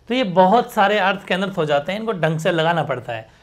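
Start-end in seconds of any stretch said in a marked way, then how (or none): none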